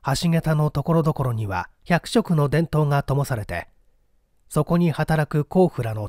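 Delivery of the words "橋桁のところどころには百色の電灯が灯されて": usual speaking voice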